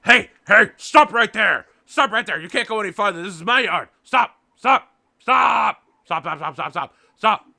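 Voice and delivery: high, loud, frantic dog shouting; barking guard dog. Acted. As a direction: yell it like a dog barking at intruders. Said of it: "the dog is standing over both of you".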